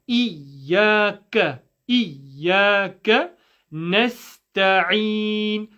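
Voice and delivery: إياك slow with a held doubled ya; slow نستعين with a clear seen; ya shadda. Shown as a demonstration. Teacher drilling the ya shadda for students. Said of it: "commit to the ya shadda".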